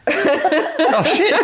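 a hammed-up shocked squeal through laughter; mock-startled Nate. Nate learns of the break-in, high laughing yelp. Nate hears about the robbery.

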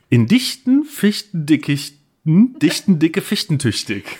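slow, deliberate tongue twister. Shown as a demonstration. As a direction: feel your way through it slowly. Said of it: "Sage dreimal hintereinander".

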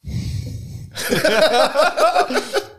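high giggle. Real, not acted. Reaction to tasting drink.

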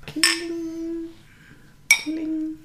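high sing-song clink; clinking glasses sound. Acted.

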